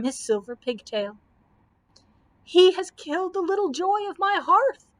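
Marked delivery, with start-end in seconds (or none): 2.4-4.9 high, loud grief-stricken screech; old wizard screeching over his slain dragon. Acted. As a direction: shriek it in outraged grief. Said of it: "screeched the old man".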